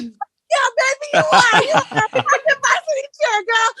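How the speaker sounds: high, loud, laughing cheer; joyful shout. Retold or imitated. Mom cheering daughter's varsity spot, joyous. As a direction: shout it, bursting with pride. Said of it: "And I said".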